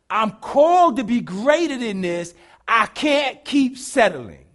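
loud, slow declaration of calling; inner cry. Retold or imitated. Preacher voicing the heart's cry, loud and slow. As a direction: proclaim it like a vow to yourself. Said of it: "the scream in your inside saying".

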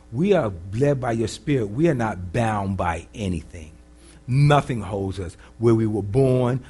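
measured, earnest congregational prayer; leading prayer. Real, not acted. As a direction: pray aloud for the room, phrase by phrase.

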